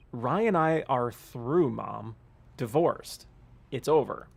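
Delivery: ordinary talk, nothing marked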